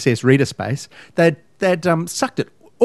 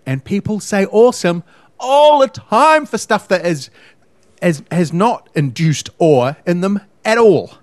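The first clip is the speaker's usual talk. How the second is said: exasperated rant; 6 semitones higher, 4 dB louder than usual. Real, not acted. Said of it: "awesome is an overwhelming feeling".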